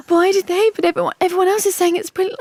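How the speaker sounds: high-pitched, hurried wounded protest; mock indignant disbelief. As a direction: act out being baffled by a bad review. Acting out reaction to bad review.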